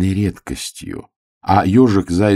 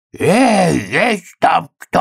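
wolf's voice; 11 semitones higher, 3 dB louder, much slower than usual. Retold or imitated. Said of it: "спросил голосом волка".

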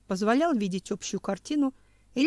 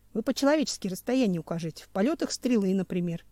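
ordinary delivery, nothing marked

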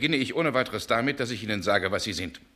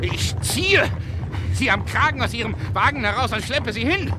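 loud, higher threatening shout; angry threat; 11 semitones higher, 6 dB louder than usual. Acted. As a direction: threaten to drag him off. Threatening the drunk.

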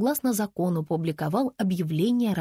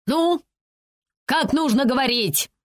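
raised-pitch demanding question; stern prompting; 5 semitones higher, 4 dB louder than usual. A narrator's reading. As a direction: demand the correct answer from a child. He sternly drills the frightened girl. (требовательно, повышенным тоном)

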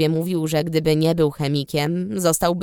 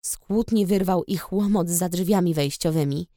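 plain reading voice

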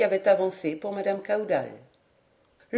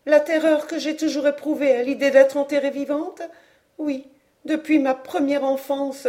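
high-pitched anguished confession; trembling dread; 5 semitones higher than usual. A narrator's reading. Aunt tells of her lifelong fear of live burial, fearful. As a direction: relive the nightmare in a raised voice. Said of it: "ajouta-t-elle les lèvres tremblantes".

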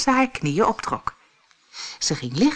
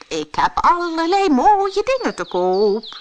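much higher, loud pedlar cry in a put-on voice; disguised queen calling; 12 semitones higher, 6 dB louder than usual. Queen disguised as pedlar calls at the cottage, high loud put-on voice. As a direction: hawk your wares in a changed voice. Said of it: "riep ze".

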